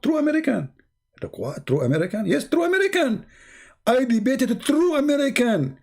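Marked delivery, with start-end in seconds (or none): 0.0-0.7 high, puffed-up "True American"; boastful imitation. Mimicking the debater's boast. 2.3-5.8 mocking imitation. Mimicking the debater's boast.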